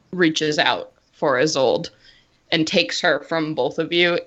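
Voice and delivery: normal talking voice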